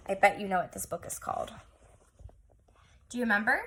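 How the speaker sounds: ordinary delivery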